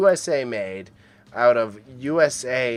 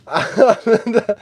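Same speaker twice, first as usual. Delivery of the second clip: loud high laugh; 10 semitones higher, 8 dB louder than usual. Real, not acted. Laughing after demanding likes.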